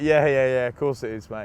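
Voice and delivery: loud, mocking yeah yeah yeah; sarcastic disbelief. Player mocking a call he thinks is a wind-up. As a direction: brush off the caller as a prank. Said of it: "Didn't believe it for one second".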